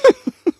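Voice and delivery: brief, high chuckle; short chuckle. Acted. Answering a question about other worlds, short chuckle. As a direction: let out a quick amused laugh. Amused reply to other agent.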